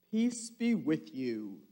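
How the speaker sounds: a touch of calm peace; gentle greeting. Acted. Jesus greeting frightened disciples, lightly calm. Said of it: "he said".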